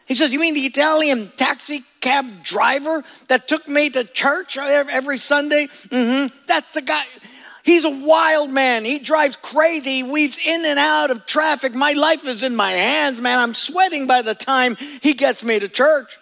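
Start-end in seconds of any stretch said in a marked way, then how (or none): 0.3-7.1 retold friend, raised voice. Preacher voicing the taxi story. 7.6-16.2 retold complaint. Retelling the friend's taxi complaint.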